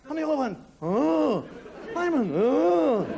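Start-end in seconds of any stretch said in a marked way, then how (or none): 0.8-1.4 loud group groan of dismay, acted; shocked "oh". Family reacts to death news. 2.3-3.1 loud, high mock lament; a child echoing the family's shock; copied dismayed "oh".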